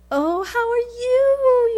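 high, slow, soft sugary hello; sweet greeting. Retold or imitated. Sweet greeting to little girl.

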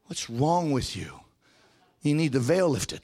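usual speaking voice

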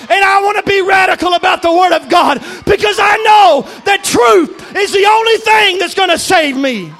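loud, high-pitched, sustained pulpit roar; fervent preaching shout. Acted. Preacher owning being a fanatic for the Word, fervent shout. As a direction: build a relentless shouted declaration of faith.

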